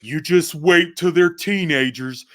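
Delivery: slow, drawn-out know-it-all warning; mock ominous warning. Other parents warning dad about teenagers, mock ominous. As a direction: stretch it out like a foreboding caution. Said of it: "and people go".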